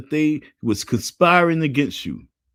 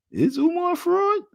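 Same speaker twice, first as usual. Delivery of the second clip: high, slow, disbelieving question; mocking peers. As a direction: ask it as a mocking classmate, way up high. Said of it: "peers gonna be looking at you like".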